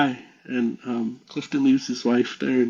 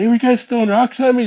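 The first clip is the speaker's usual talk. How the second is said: fast, high, louder mock confrontation; retold indignant accusation; 10 semitones higher, 6 dB louder, faster than usual. Brother-in-law confronts the two waiting for him, acted indignant demand. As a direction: call them out in a rush.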